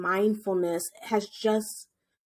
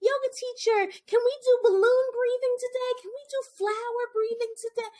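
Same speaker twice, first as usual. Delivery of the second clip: put-on much higher pleading kid voice; begging little kids; 13 semitones higher, faster than usual. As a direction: plead like eager children.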